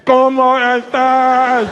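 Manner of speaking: mocking a Portuguese speaker greeting; mock Portuguese accent. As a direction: drag out "¿Cómo estás?" like bad Spanish. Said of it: "Here's a Portuguese guy".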